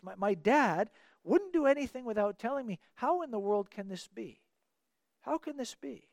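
usual speaking voice

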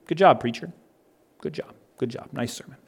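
very soft, murmured imitation of well-wishers; polite compliments. Congregants who heard the sermon praising the preacher, imitated in a murmur. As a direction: toss out quiet praise, fading almost to nothing.

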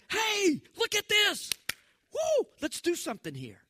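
high, loud, eager exclamation; a playful, thrilled shout of discovery; excited call. Pretend. Preacher voices God excited.

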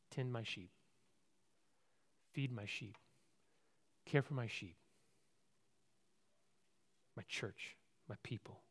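low, very soft, with long pauses; quiet, solemn charge. Acted. Jesus entrusting his people to Peter, quiet and solemn. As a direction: give each short command softly and low.

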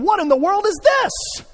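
high, loud hammed-up angry demand; mock outraged shout. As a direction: bellow it like a furious boss. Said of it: "God's not coming to Adam saying".

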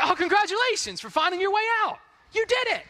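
doctor gushing at the newborn, high and fast; mock doctor. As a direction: gush at the baby like a cheesy doctor. Mock doctor congratulating baby.

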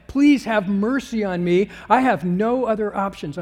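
loud, insistent pleading; impassioned plea. Acted. Acting out blind man's impassioned plea.

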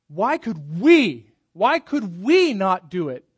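slow, with "we" pressed hard; stress on we. Shown as a demonstration. Preacher showing the disciples' emphatic question. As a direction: lean into "we" slowly and loudly. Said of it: "the emphatic here in".

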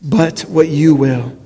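measured words for others to say along; leading in unison. Shown as a demonstration. Pastor has the congregation join Jesus' prayer, slow low unison lead. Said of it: "but say this last part".

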